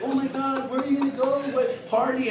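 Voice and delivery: put-on high-pitched, chatty old lady; little old lady. Old attendant quizzing the girlfriend, high and chatty. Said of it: "the little old lady".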